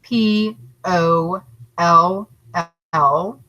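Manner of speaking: letters spelled out slowly, one by one; slow spelling. Shown as a demonstration. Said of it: "write the word pollution".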